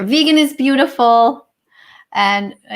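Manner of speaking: usual speaking voice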